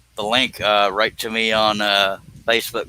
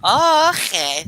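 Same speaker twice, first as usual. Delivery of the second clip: surprised realization; 14 semitones higher, much slower than usual. Real, not acted. Realizes where guest lives.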